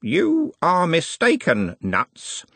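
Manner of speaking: slow, deliberate correction; slowly. A narrator's reading. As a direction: draw out each word pointedly. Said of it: "said Smeems slowly".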